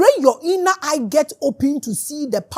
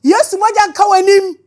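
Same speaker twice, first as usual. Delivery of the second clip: higher and much louder, shouting the line out; shouted repeat; 7 semitones higher, 10 dB louder than usual. Real, not acted. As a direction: hurl it back, shouting. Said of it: "Me say dai".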